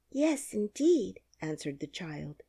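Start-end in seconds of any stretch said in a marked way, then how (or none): 0.1-1.2 put-on high, loud, slow girlish yes; eagerly agreeing little girl; a touch of childish enthusiasm. Dorothy answers eagerly.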